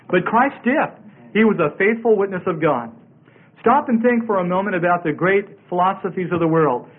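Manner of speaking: ordinary talk, nothing marked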